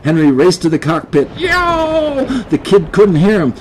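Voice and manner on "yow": very high yowled cry; cat's yowl. Acted. Cat calls to the kid below deck, yowling.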